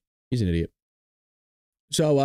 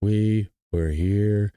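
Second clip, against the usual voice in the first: dragged out very slowly, lower, mocking a slow speaker; mock slow talker; 13 semitones lower, slower than usual. Streamer mocks the senator's slow speech, very slow drawn-out voice. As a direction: stretch every word like a boring politician. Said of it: "people talk slow, slowly".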